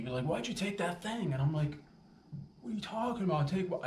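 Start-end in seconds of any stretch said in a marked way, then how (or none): 0.2-1.3 high-pitched mock accusation; accusing granny. Granny accusing grandchild of taking something, mock accusing. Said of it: "she'd accuse me". 2.6-3.8 high-pitched, worked-up denial; mock nervous protest. Accused grandchild protests to granny, nervous and worked up. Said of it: "I get all nervous".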